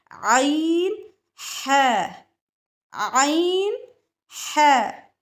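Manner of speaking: आइन drawn out slowly from the mid throat, then है; letter demo. Shown as a demonstration. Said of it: "आइन की सिफ़ात और मखरिज". (आइन को हलक से धीरे अदा करना)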